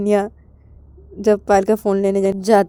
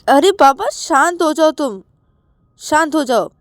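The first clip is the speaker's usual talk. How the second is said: exasperated; 6 semitones higher, 3 dB louder than usual. Acted. Acted plea to calm down.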